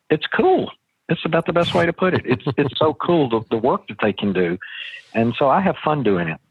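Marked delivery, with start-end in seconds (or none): 0.1-3.3 excited. Real, not acted. Enthusing about exotic remodeling.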